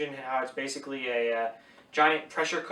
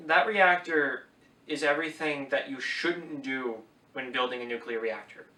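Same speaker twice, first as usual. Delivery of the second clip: ordinary talk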